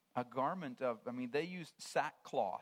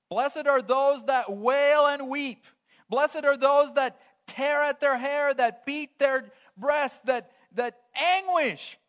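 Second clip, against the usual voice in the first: much higher and much louder, slow, proclaiming to the congregation; full force preaching; 11 semitones higher, 16 dB louder, slower than usual. Acted. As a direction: thunder it from the pulpit, slowly. Proclaiming the beatitude on mourning.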